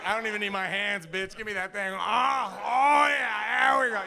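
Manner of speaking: loud, high boast building to a shout; mock-boastful shouting to the doctor; hammed-up cocky bravado. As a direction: play it gleefully over-eager, rising to mock ecstasy.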